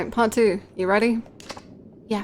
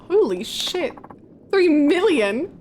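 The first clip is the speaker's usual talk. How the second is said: high, slow shocked disbelief; 8 semitones higher, 3 dB louder, much slower than usual. Real, not acted. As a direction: gasp out the sum in shock. Shocked by the sum.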